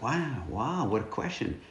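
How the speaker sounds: soft, awed murmur; stunned hush. Retold or imitated. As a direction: let the question take your breath away. Retelling own stunned reaction.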